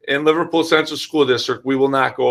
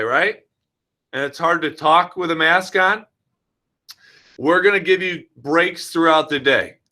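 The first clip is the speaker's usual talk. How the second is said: put-on bright, higher teacher voice for little kids; talking to children; 4 semitones higher than usual. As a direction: coax the kindergartners like a classroom teacher. Principal addresses kindergarten kids directly.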